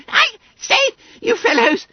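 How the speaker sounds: very high, loud squealed denial; alarmed roar. Acted. Bunter about to be grabbed.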